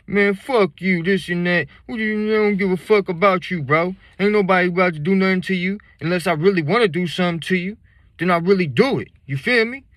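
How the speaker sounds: put-on high, loud, fast drunken rant; angry drunk. Drunk man ranting at the teller, high, loud and fast.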